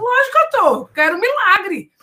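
high, loud, eager voice; like someone impatient for a miracle; eager believer. Retold or imitated. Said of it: "as pessoas podem pensar assim".

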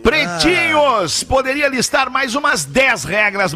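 email reading. Acted. Reads listener email aloud.

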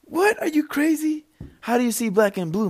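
mock the friend who can't believe it; incredulous friend. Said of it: "And he's like".

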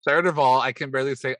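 building mock rant. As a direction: escalate the list. Listing points, escalating.

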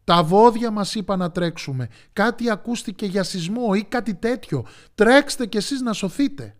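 high, animated alarm; urgent warning. A narrator's reading. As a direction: pass on an alarm in a hurry. Deer pass on warning.

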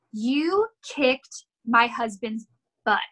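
each word drawn out for effect; slow, pointed delivery. Retold or imitated. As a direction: imitate a wife's deliberate, dramatic announcement. Client's wife confronts the trainer.

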